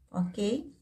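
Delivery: ordinary talk, nothing marked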